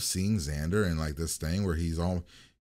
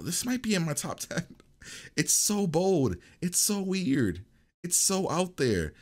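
excited gushing; 9 semitones higher than usual. Real, not acted. Gushing about the episode.

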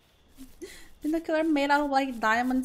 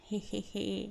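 snickering; 3 semitones lower, 6 dB quieter than usual. Pretend. Sneaky villain laugh.

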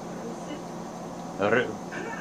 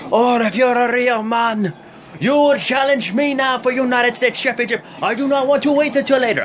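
imitating Rusev in a higher, hammed-up Russian accent; a clumsy Russian accent, pitched up, for the Bulgarian wrestler; bad Russian accent; 7 semitones higher than usual. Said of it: "I know it's a bad accent".

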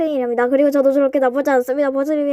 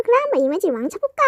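scheming villain; 3 semitones higher, faster than usual. Acted.

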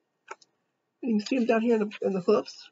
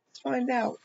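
ordinary delivery, nothing marked

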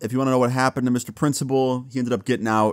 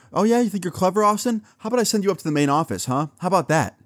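higher-pitched, sarcastic teacher taunt; mocking angry teacher; 9 semitones higher, faster than usual. Teacher to student who mocked her, high sarcastic angry taunt. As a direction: needle him like a spiteful teacher. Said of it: "she was super mad about it".

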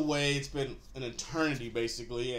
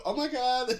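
higher-pitched imitated gasp of disbelief; lightly hammed-up shock, pitched up; mock amazement; 10 semitones higher, 3 dB louder than usual. Those two dudes react to her, mock amazement.

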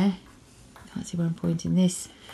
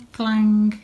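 vocal bell clang, louder; 3 semitones higher, 7 dB louder than usual. Pretend. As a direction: ring it out like a bell. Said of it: "I just did it".

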